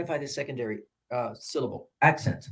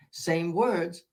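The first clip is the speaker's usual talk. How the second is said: ordinary delivery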